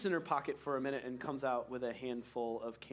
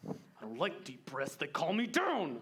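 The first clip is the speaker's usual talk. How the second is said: blustering king; 7 semitones higher, 3 dB louder than usual. Acted. Angry king voiced by GM.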